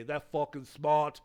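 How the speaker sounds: loud, slowed-down mock Boston accent; bad Boston accent. Bostonians raving about Marcus Smart, bad Boston accent. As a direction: belt it out like a bad impression of a Bostonian. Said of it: "that's my bad, uh, Boston accent".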